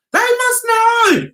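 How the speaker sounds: high, slow knowing drawl; mock knowing voice. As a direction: play them as smugly aware. Mocking the women's assumed thought.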